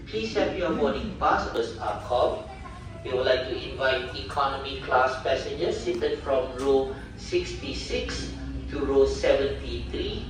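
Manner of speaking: boarding call over the PA speaker; formal gate announcement through loudspeaker; airport announcement. Real, not acted.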